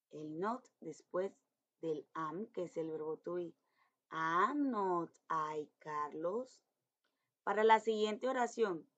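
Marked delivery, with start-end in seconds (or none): 4.1-6.6 very slow, each word set apart; slow dictation. Shown as a demonstration. Teacher dictating the negative question slowly to pupils. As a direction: read it out for the children to copy. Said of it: "teacher reads negative question".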